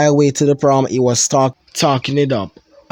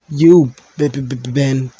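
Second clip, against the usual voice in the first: frightened stammer; 2 semitones higher, 4 dB louder than usual. Acted. Terrified stammer.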